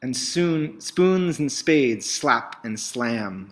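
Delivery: plain reading voice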